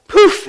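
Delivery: a sudden high burst of sound for the shot; slingshot poof. Acted. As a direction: pop the rock out like a sling.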